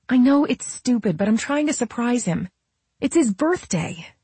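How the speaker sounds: high, earnest pleading; Camille. A narrator's reading. Camille explaining the birthday surprise to Nola, pleading. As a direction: pitch it high and plead sheepishly.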